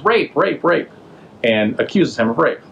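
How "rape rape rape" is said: mock yell. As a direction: imitate a yell at normal volume. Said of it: "So she yells".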